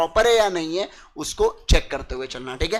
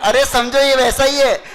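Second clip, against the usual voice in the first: laughing and louder, amused; 3 semitones higher, 5 dB louder than usual. Real, not acted. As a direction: laugh through the point. Laughing at the Deepika joke. (हँसते हुए ज़ोर से समझाना)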